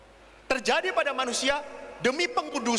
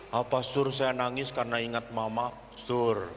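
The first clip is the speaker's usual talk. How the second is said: imitated tearful girl; 10 semitones lower than usual. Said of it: "kalau perempuan saya tanya".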